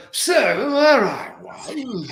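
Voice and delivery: Peter O'Toole. Acted. Actor demonstrating his movie accent, tipsy theatrical English. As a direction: slur it theatrically, high and swooping. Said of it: "drunk Peter O'Toole story".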